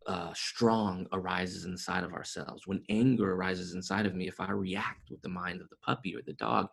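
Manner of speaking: usual speaking voice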